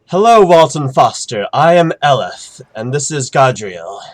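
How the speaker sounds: loud, high, proclaiming angel; booming angel voice. Acted. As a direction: announce it like a herald from heaven. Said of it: "angels are always, like, very loud".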